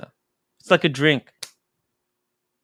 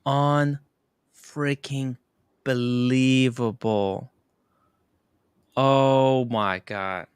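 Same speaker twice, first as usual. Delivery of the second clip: very slow, stretched-out amazement; stunned disbelief; much slower than usual. Real, not acted. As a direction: draw each word out in awe. Stunned by a donation.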